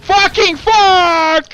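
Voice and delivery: slow, drawn-out angry yell; loud yell. Real, not acted. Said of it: "I yelled that quite loud into the mic".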